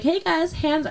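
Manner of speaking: lightly put-on fake teacher voice; sing-song classroom call to the kids. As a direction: preschool teacher calling the class. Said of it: "my teacher voice is so fake".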